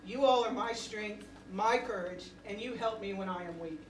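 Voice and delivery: ordinary delivery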